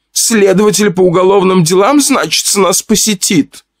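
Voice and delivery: high, teasing and coy; wife playfully teasing about the guest. Acted. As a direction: tease lightly with a sly lilt. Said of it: "игриво произнесла Аграфена Ивановна".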